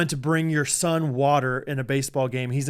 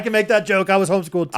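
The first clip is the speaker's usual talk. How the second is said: gleeful; 6 semitones higher, 4 dB louder, faster than usual. Retold or imitated. Jesse's line voiced again.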